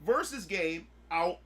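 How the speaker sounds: high, heated complaint; fanboy outrage; indignant rant. Retold or imitated. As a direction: rant at the unfairness. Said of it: "I was like".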